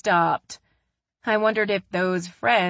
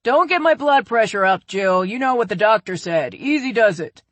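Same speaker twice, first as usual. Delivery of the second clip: lightly voiced dad, louder and higher; a mock-scolding father cutting in; a hint of a stubborn dad waving off an argument; dad piping up; 5 semitones higher, 8 dB louder than usual. A narrator's reading. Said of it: "he piped up".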